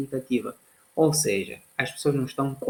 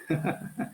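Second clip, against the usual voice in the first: short breathy chuckle; light chuckle; 4 semitones higher, 5 dB quieter than usual. Real, not acted. Chuckles at the surprising fact.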